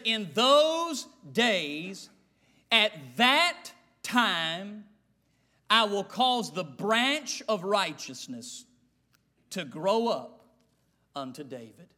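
very slow, drawn-out reading with long pauses; solemn scripture reading. Acted. Preacher reads the verse to the congregation, slow and solemn.

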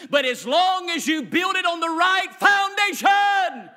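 building from steady to a loud, high cry; preach. Acted. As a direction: swell it up to a shouting climax.

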